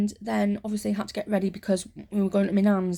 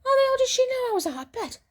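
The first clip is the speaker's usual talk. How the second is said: incredulous; faster than usual. Real, not acted. Disbelieving aside.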